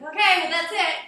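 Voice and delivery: loud, high mock wrap-up; abrupt speech-ender. Teacher imitating people who end speeches badly, loud and abrupt. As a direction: blurt a clumsy ending, loud and high. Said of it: "Lots of people are like".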